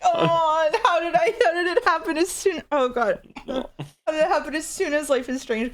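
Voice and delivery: laughing outcry. Real, not acted. Laughing dismay at nosebleed.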